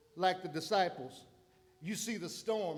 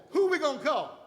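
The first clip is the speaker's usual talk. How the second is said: panicked question; 8 semitones higher, 9 dB louder than usual. Retold or imitated. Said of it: "They decided".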